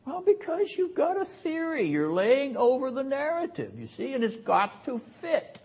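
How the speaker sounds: high, soft, put-on explaining voice; mock explaining. Preacher answering for the mistaken commentators, mock explaining. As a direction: answer in a small, high mocking voice.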